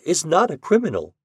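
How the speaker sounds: high-pitched denial; protesting. A narrator's reading. Widow defending her husband as no criminal, protesting. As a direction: deny it earnestly, pitch well up. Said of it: "she protested".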